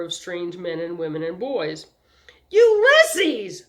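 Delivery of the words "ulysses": high, loud cry of the name; an older brother shouting to his trapped brother, loud and high; shouted. A narrator's reading. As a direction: yell for your little brother. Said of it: "Homer shouted".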